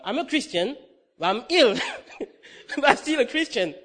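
loud, high plea broken by laughs; comic self-mocking protest; laughing protest. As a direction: plead your case while laughing. Retold plea, laughing at himself.

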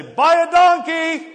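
high, loud, drawn-out shout of Buy a donkey; mock yell at drivers. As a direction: yell it out the window at a slow car, teasing. Yelling at slow drivers while overtaking.

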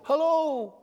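high, drawn-out call at normal volume; mock long-distance hello; calling from afar. Worshipper calling to God from outside the tabernacle, high mock call.

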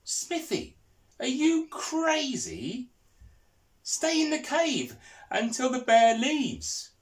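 high, frantic shout from a tree; frantic call. Acted. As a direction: call out in a panic, pitched high. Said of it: "frantically called out".

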